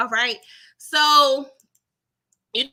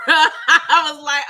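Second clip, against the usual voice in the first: laughing; 4 semitones higher, 9 dB louder, much slower than usual. Real, not acted. Laughing hard.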